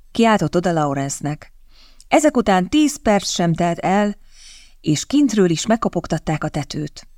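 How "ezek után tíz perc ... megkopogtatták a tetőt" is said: ordinary delivery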